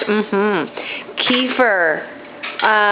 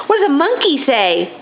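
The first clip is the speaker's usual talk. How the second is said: usual speaking voice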